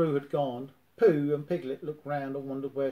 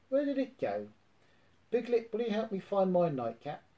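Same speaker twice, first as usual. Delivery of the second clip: higher, fretful little bear hunting for his cap; a lost-and-bothered Pooh; Pooh, puzzled; 6 semitones higher than usual. A narrator's reading. Pooh asks Piglet for help finding his nightcap, puzzled.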